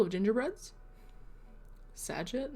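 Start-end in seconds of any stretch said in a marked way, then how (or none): 1.9-2.5 rising, puzzled read of Saget; puzzled question. Real, not acted. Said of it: "sorry, my New York accent is coming through".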